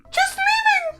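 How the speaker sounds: very high squeak. A narrator's reading. Kids fleeing from Billy, high squeak. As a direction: squeak it in fright. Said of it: "they squeaked".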